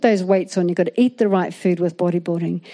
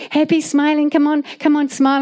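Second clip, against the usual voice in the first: higher-pitched mock chirpy coaxing; hammed-up happy pestering in a raised pitch; imitated cheerfulness; 6 semitones higher than usual. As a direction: imitate a bouncy husband urging a smile. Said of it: "trying to kinda like be like me, you know, happy".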